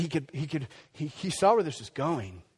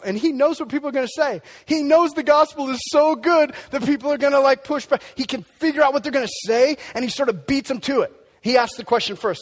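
loud, high, driving sermon voice; preaching at full force; 9 semitones higher, 9 dB louder than usual. Acted. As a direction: push it out, rising fervor.

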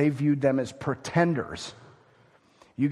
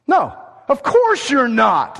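slow, loud, higher dismissive no; scornful; 9 semitones higher, 8 dB louder, slower than usual. Acted. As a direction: drag it out as if obvious. Voicing the expected answer.

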